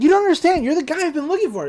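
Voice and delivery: high, loud, excited outburst; excited boy. Retold or imitated. Boy telling the author he's been looking for him, excited. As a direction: gush at your long-sought idol, high. Dipper finally finds his idol.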